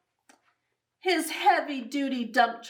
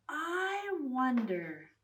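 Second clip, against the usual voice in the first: usual speaking voice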